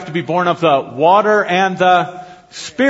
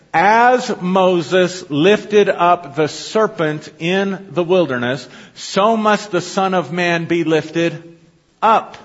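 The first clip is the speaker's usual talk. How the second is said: very slow scripture reading; much slower than usual. Shown as a demonstration. Preacher reading the verse slowly to his congregation. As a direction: drag each phrase of the verse out slowly. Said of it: "Watch this".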